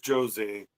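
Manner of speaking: "Jose" drawn out as zho-ZAY, J sound first; Brazilian Jose with J. Shown as a demonstration. Host explaining it's Brazilian, "Jose" pronounced slowly with a J. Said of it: "it's Brazilian, so it's Jose".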